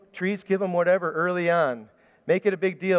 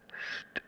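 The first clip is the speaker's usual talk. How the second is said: soft breathy shh to get attention in the dark; whispered hiss through the teeth; brother's whispered shh; 14 dB quieter than usual. Retold or imitated. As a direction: brother signalling across a dark bedroom. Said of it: "he could just go".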